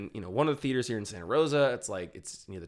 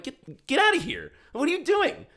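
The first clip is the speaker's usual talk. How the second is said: indignant telling-off; 4 dB louder than usual. Retold or imitated. Moviegoer to seven noisy kids, retold indignant scolding. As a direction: order them out in retold disbelief.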